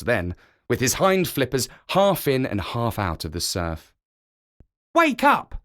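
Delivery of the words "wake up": high, sharp shout; barking young seal. A narrator's reading. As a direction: bark it out to wake him. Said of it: "barked Kotick".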